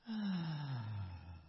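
drawn-out, falling storybook sigh; slowly descending exhale; long sigh. A narrator's reading. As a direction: let out a long, acted sigh. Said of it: "let out a long sigh".

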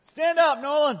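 loud, high mock command; playful shout. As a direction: boom it out at the boy in fun. Playfully commanding the boy to stand.

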